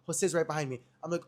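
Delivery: normal talking voice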